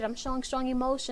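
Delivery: usual speaking voice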